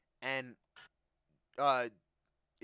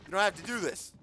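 higher, louder hammed-up pleading; mock plea; 6 semitones higher, 6 dB louder than usual. Gamer mock-begging the monster, higher and louder. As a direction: beg the monster dramatically.

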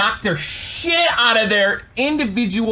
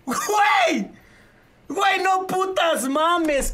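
much higher exasperated shouts; exasperated yelling; 9 semitones higher, slower than usual. Real, not acted. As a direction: yell out in frustration at the game. Raging at the game in Spanish.

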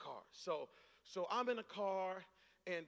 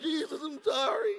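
tearful plea; 6 semitones higher, 9 dB louder than usual. Retold or imitated. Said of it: "I'm just like".

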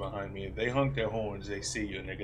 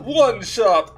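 high loud vampire count; Count Von Count; 12 semitones higher, 19 dB louder than usual. Acted. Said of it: "That's my Count Von Count impression".

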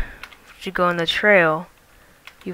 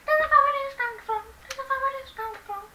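very high, fast, squeaky chant; 8 dB quieter, faster than usual. Pretend. Repeating a line in put-on voice.

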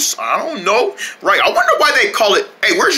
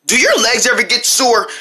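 normal talking voice

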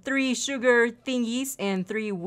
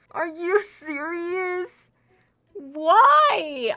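disbelief; 6 semitones higher, 4 dB louder, much slower than usual. Real, not acted. Disbelief after losing name tags.

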